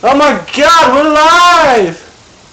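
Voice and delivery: high, loud and thrilled; excited. Real, not acted. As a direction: burst out in surprise. Excited at going live.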